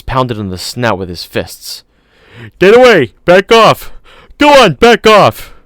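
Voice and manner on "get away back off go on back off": high, loud desperate yelling; shouting at the top of his lungs. A narrator's reading. Man yelling at a young dinosaur to back off, full-throated shout. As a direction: bellow it to scare the beast off. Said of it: "Regis was shouting at the top of his lungs".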